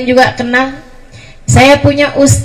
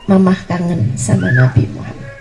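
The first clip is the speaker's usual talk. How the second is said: lower, softer, aching repeat of the line; tender longing; 6 semitones lower, 4 dB quieter than usual. Acted. Mother repeating to her child that she misses the Prophet, tenderly low. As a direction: let the longing sink in on the repeat.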